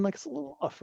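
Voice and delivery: normal talking voice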